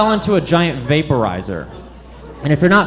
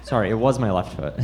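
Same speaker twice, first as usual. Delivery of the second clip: usual speaking voice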